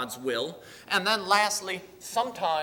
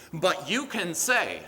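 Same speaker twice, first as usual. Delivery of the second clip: ordinary delivery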